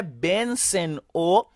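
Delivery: pronouns drawn out one by one; slow, spaced language drill; slow model pronunciation. Shown as a demonstration. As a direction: recite the list slowly for learners to repeat. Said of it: "Répétez avec moi".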